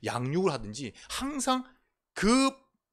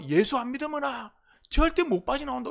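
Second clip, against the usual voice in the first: warning; 9 semitones higher than usual. Retold or imitated. Preacher retells warning parents.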